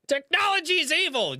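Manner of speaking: high, hammed-up mock outrage; mocking commenter. Streamer imitating the tech-hating commenter, mocking.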